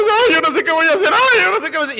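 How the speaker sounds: high-pitched fretting; hammed-up helpless worrier; mock panic. Acted worried believer in a trial. (angustia fingida y exagerada)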